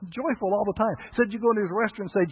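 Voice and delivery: high, loud, beaming greeting; joyful Jerry. Retold or imitated. Preacher voicing Jerry's cheery answer, high and loud. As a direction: sell it like the happiest man alive. Exuberant restaurant owner Jerry quoted.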